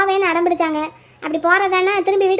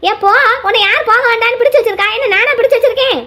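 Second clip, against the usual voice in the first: raised-pitch, indignant comeback; quarrelling, voice pushed up in protest; angry retort; 3 semitones higher, 4 dB louder than usual. Acted. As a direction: throw the accusation back at her. Quarrel, retorts to accusing woman.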